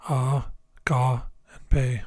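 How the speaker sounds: "A, ga, and pe" broken into separate syllables. Shown as a demonstration. Teacher showing the three syllables of agape, said one by one. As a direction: sound out "A, ga, pe" one at a time, pausing between. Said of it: "agape has three syllables".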